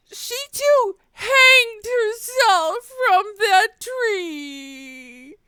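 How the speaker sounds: high, hammed-up weeping voice of a grieving neighbor; a neighbor crying over a tree, mock sobbing high voice. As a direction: squeeze out a tearful high wail as the bereaved man. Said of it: "why are you crying today".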